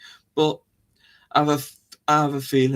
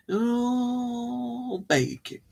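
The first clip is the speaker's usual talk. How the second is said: high, very slow, stretched-out; mock drawn-out exclamation; 10 semitones higher, 4 dB quieter, much slower than usual. Teasing listeners about their baking, playful high drawn-out voice. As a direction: hold the words long and high, playfully. Repeats the line in silly drawn-out voice.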